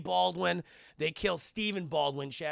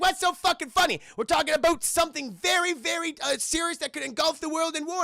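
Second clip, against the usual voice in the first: mock angry; 8 semitones higher, 5 dB louder than usual. Voicing outraged Canadian.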